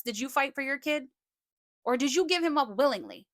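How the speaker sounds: ordinary delivery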